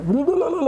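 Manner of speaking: high, rolling gobbling noise; imitated gobbling noise. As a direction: gobble greedily like a piglet. Imitating greedy gobbling of food.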